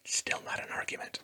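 whispered flat aside. Real, not acted. A whispered aside to viewers about bad arguments. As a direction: breathe it under your voice. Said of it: "whispered aside".

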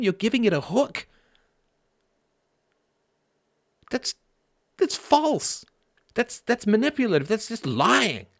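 high-pitched, incredulous rant with punched key words; mock outrage. Speaker mocking outrage at lying to fish, hammed-up indignation.

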